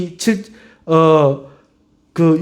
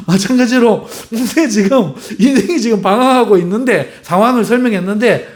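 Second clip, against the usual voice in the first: ordinary talk, nothing marked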